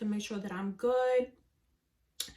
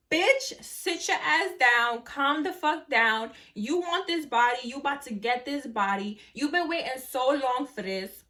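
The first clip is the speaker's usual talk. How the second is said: sassy inner diva; 2 semitones higher than usual. Retold or imitated. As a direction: hype yourself up like a bad bitch. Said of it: "the bad bitch was like".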